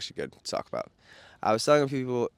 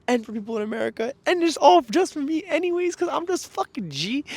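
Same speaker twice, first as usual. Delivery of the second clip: much higher, louder cocky flourish; boastful; 4 dB louder than usual. Real, not acted. Vlogger bragging to viewers, cocky high. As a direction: brag it out proudly.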